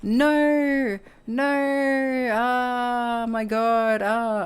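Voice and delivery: high, drawn-out wail of dismay; hammed-up panicked whine, sliding gradually down; mock freaking out. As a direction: imitate someone melting down. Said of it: "he starts freaking out".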